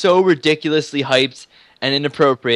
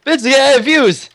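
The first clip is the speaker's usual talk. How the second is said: mock cheer; 12 semitones higher, 6 dB louder, much slower than usual. Mock cheering over views.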